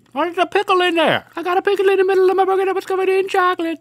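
very high fast squeal; high squeaky voice. Acted. High excited pitch.

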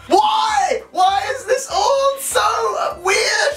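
high-pitched, drawn-out cry of disbelief; exasperated wail. Real, not acted. Baffled learner reacting to a confusing language, exasperated wail. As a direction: wail it in comic despair.

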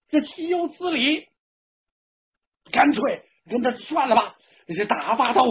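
indignant relative speaking up; clamouring outrage. A narrator's reading. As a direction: voice an affronted guest. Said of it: "嚷嚷什么的都有".

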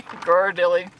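ordinary talk, nothing marked

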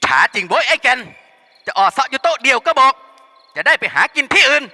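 bawling yell. Acted. Henchman banging the table yelling for service. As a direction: bellow at her like a drunk thug. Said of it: "แหกปากเรียก".

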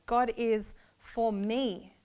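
slow, deliberate "God is for me" with "God" stressed; slow personal emphasis. Shown as a demonstration. Teacher personalizing the verse, slow and deliberate. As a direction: savour each word slowly. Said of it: "but I'm personalizing it".

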